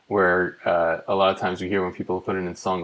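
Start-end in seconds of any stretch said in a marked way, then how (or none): none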